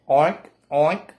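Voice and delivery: short grunted pig oinks; pig oinking. Acted. Reader voices the pig for children, oinking. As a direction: oink like a storybook pig. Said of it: "grunted the pig".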